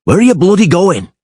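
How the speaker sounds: shout. A narrator's reading. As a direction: yell after the runaway. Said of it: "shouted Geralt".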